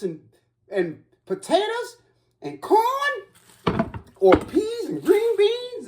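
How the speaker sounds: very high, drawn-out squeal; high-pitched, stretched-out delight; giddy excitement. Real, not acted. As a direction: squeal slowly, overjoyed at the food. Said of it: "I ain't been this excited".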